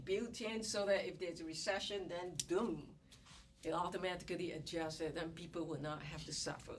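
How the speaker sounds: normal talking voice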